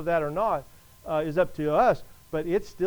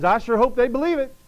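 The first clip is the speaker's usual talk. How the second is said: higher, louder mock fretting; mock hand-wringing worry; 5 semitones higher, 5 dB louder than usual. Preacher mocks God anxiously hoping for belief, fretful. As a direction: fret like someone wringing his hands. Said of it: "wringing his hands".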